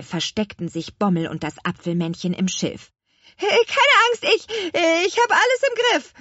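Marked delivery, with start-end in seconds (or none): 3.4-6.1 high, loud, faltering reassurance; calling out. A narrator's reading. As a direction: shout that there's no danger, nervously. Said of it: "rief die Apfelhexe". (hoch und aufgeregt gerufen)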